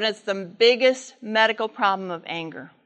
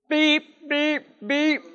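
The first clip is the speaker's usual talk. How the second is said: horn beeps; 4 semitones higher, slower than usual. Retold or imitated. Father honking imitated.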